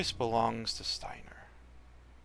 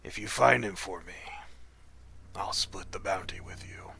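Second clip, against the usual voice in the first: rasping whisper; 3 semitones lower than usual. Acted. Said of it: "wet, raspy whisper".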